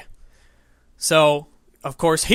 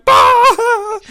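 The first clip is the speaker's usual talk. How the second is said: loud, very high explosive laugh burst; sudden high yelled laugh from the lobby; mock burst of laughter; 9 dB louder than usual. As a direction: blurt it out like a whole lobby cracking up. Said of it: "sixteen people going".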